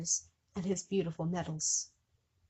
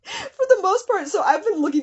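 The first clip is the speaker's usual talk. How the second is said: laughing through words; 11 semitones higher, 8 dB louder than usual. Real, not acted. Still laughing at babe line.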